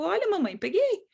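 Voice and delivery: put-on high-pitched little kid voice; high, childlike announcement; child showing mother. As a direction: pipe up like a child reporting to mum. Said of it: "a criança pega o brinquedo e te olha".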